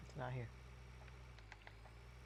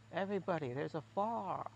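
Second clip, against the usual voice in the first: higher, lightly drawled country call with "fire" as "far"; half-hearted Southern alarm to everybody; mock Southern warning; 4 semitones higher than usual. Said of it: "funny American accents".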